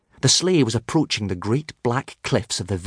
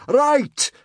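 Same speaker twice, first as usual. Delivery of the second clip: high, punchy bellow; sudden shouted command, pitch way up; 4 dB louder than usual. A narrator's reading. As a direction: bellow it to call everyone to order. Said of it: "bellowed Gobber".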